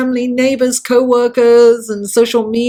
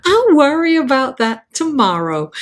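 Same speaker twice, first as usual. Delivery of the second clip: bad American accent, higher and swooping down; Scarlett O'Hara; 5 semitones higher than usual. Repeating the film line for fun, really bad American accent. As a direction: put on a hammy American film-heroine accent.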